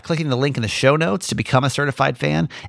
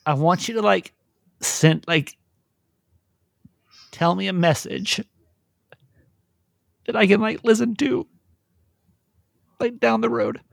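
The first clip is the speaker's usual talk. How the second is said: tearful; 6 semitones higher than usual. Real, not acted. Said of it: "this might make me emotional".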